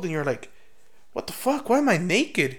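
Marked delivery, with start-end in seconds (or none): 1.1-2.6 higher-pitched, bewildered disbelief; mock alarm. Acting out waking up naked, confused.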